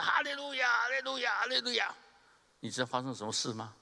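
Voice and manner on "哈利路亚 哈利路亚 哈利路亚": very high, ringing shouts of hallelujah; a retold, full-throated cry of praise; shouted hallelujahs. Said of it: "他又喊了三声".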